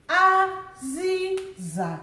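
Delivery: Aziza drawn out slowly and high; teacher modelling Aziza slowly; slow Igbo word. Shown as a demonstration. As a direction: sound out Aziza, high tones held. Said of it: "let's do the tone marks together".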